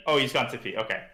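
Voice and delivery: ordinary talk, nothing marked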